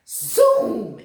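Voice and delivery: loud, high zoom; zoom sound. A narrator's reading. Narrator making the wheelchair zoom, loudly. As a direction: make the zoom race past.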